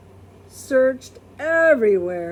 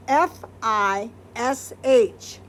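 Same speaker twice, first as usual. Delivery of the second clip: slow, letter by letter; spelled out; slower than usual. Shown as a demonstration. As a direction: spell it out slowly for a child. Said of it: "spelling the word out".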